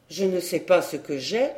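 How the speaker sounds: plain reading voice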